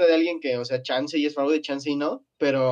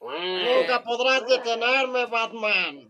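high, slow cartoon supervillain taunt; Penguin villain voice; 7 semitones higher, much slower than usual. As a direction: gloat like the Penguin to Batman. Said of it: "Es el pingüino".

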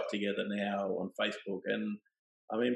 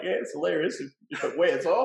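wry laughing quip; 7 semitones higher, 4 dB louder than usual. Retold or imitated. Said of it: "I'm like".

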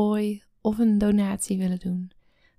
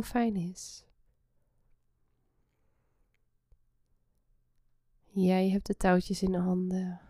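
soft spa whisper; 4 dB quieter than usual. Acted. Guides a relaxation meditation.

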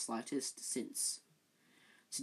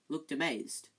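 ordinary delivery

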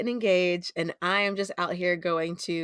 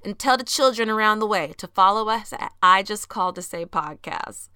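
ordinary talk

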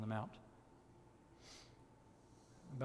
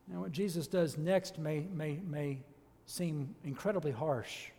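normal talking voice

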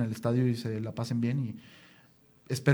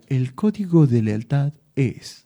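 imitating Rodrigo; 5 dB louder, slower than usual. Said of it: "Rodrigo decía".

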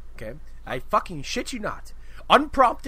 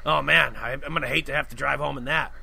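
imitating the kid; 4 semitones lower than usual. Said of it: "He sounds like this".

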